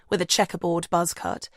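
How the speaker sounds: ordinary delivery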